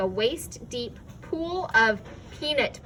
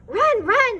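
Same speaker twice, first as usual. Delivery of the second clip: much higher, loud shout; 12 semitones higher, 10 dB louder than usual. A narrator's reading. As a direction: shout the warning hard. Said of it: "it shouted again".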